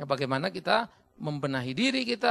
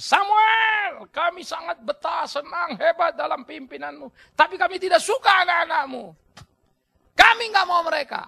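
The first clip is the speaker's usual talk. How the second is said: high loud crowd demand; Israelites shouting; 10 semitones higher, 8 dB louder than usual. Acted. Israelites shout to Samuel, high loud demanding call. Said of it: "Orang Israel datang".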